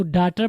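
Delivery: "डॉटर" with an open a, "DA-tar"; mispronounced daughter. Shown as a demonstration. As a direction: say "डॉटर" wrongly as daa-tar. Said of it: "डॉटर नहीं". ("डॉटर" کا غلط تلفظ، "ڈاٹر")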